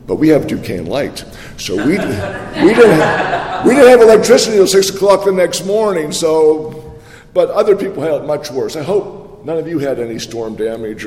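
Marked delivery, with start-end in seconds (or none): 1.8-6.1 exasperated outburst. Real, not acted. Laughing exasperation at the power company.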